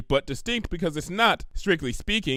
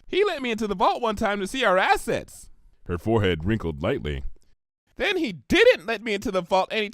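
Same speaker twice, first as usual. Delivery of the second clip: bubbly pony; 7 semitones higher than usual. Acted. Narrator voices Pinkie.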